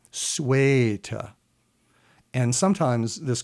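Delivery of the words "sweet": "Sweet" with a long held vowel; long Chaucer vowel. Shown as a demonstration. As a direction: stretch "Sweet" like Chaucer would. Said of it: "You hold them longer".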